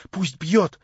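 high, loud defiant outburst. A narrator's reading. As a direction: throw it out in challenge. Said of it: "глаза её засверкали".

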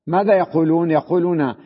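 normal talking voice

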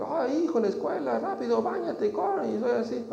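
put-on high, pushy voice rushing the child; hammed-up impatient dad hurrying his son off; father rushing his son to school, mock flustered; hurried nagging father. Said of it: "a mí me entra el celo".

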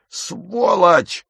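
high, angry cry; outraged high shout; yelled insult. Acted. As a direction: yell the curse at him. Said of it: "заорал он".

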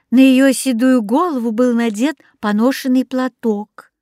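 plain reading voice

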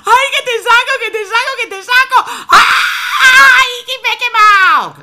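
high, loud, fast crying shout; mother trying to pull her son out; frantic wailing. Acted. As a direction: wail half-laughing in panic.

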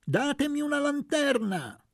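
high, loud cry for a lantern; shouted demand. A narrator's reading. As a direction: call out over the crowd. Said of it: "gridò Luca".

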